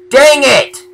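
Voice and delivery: high, loud outburst; frustrated shout. Real, not acted. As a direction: yell it in exasperation. Frustrated after losing death point.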